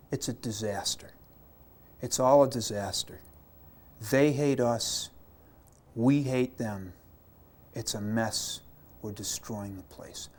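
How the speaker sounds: a soldier telling the chaplain about the war, softly grim; grim, fading report. Retold or imitated. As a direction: deliver bad news from home with heavy weight.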